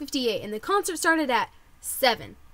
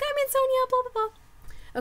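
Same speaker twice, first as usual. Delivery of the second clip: high sing-out voice; put-on chirp; 12 semitones higher, slower than usual. Claiming a friend's contest win, high chirpy put-on voice. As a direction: pipe up high, trailing into blahs.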